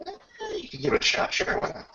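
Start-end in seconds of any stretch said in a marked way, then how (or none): none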